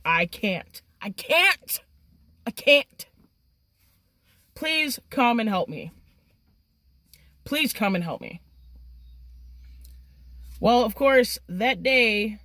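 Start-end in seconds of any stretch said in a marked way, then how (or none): 0.1-8.4 retold desperate pleading. Retold panicked plea to friend.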